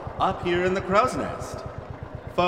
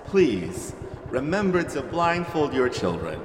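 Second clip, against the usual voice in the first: normal talking voice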